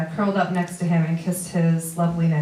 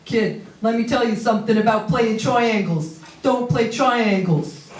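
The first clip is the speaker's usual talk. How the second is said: put-on New York accent; 5 semitones higher, slower than usual. Family friend warning the kid, thick New York accent. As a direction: play the wise old New Yorker with a thick New York accent. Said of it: "In his thick New York accent".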